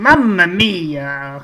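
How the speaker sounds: slow, stretched Mamma Mia; drawn-out Italian exclamation; Italian accent. Pretend. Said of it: "maybe I should have done a".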